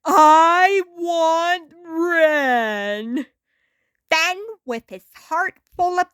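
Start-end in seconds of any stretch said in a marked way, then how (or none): 0.0-3.3 slow, sobbing plea; crying. A narrator's reading. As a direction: break down and drag the words out through tears. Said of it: "Tears streamed down his face".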